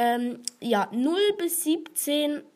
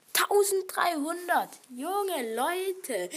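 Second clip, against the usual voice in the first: excited; 6 semitones higher, slower than usual. Real, not acted. Amazed at own play count.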